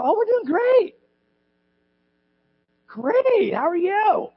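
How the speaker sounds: very high, sing-song mock enthusiasm; fake cheerful. Churchgoer answering small talk in church, fake cheerful. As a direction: put on an exaggerated everything-is-wonderful voice.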